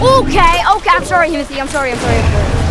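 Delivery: loud, frantic, high sorry; panicked apology. Real, not acted. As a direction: beg forgiveness in a panic.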